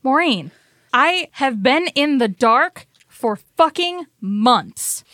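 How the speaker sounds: loud, high, emphatic outburst; fed-up anger. Acted. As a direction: hammer out the grievance at full frustration. Val confronts Maureen over secrets.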